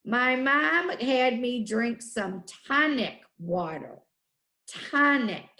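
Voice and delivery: normal talking voice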